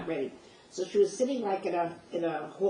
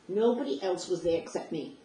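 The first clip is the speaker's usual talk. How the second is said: usual speaking voice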